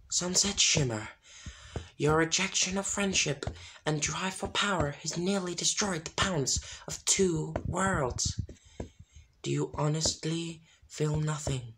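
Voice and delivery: judge her like an otherworldly spirit; spirits of harmony. A narrator's reading.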